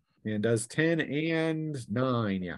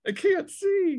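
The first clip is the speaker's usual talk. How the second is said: much higher squeaky falsetto whine; mock panicked hero; slower than usual. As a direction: play the blinded fool, squealing. GM echoes the mock voice.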